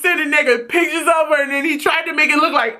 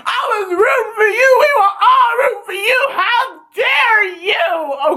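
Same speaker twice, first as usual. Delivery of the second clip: higher, tearful, half-shouted mock scolding; Tyra Banks; 8 semitones higher than usual. Fan channeling Tyra Banks scolding Karen, tearful mock rant. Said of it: "I feel like Tyra Banks".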